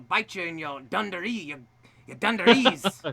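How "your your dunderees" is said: higher, louder hammed-up Aussie accent; bad Australian accent. American tries Aussie slang on an Australian friend, bad Australian accent. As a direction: badly imitate an Australian, loud and high. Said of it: "That's so bad".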